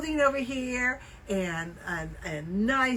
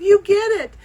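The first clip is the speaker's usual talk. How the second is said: high, loud, teary laugh-talk; crying with laughter while retelling; choked up laughing, high and loud; laughing through tears; 11 semitones higher, 8 dB louder than usual. Retold or imitated. Retold hug, crying-laughing.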